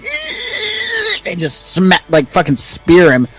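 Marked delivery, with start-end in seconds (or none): 0.0-1.3 shrill-free high war cry, much higher; high mock battle cry while tackling; high battle screech. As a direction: let out a high charging scream. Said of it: "I'm like".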